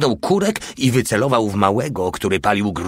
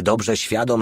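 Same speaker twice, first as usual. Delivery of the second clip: ordinary delivery, nothing marked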